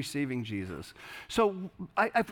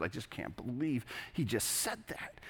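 mock muttered grumble, much softer; under your breath; 3 semitones lower, 10 dB quieter than usual. Churchgoer grumbling to himself, mock muttered under breath. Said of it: "say under your breath".

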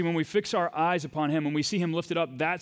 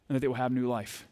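usual speaking voice

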